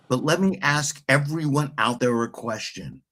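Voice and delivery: ordinary talk, nothing marked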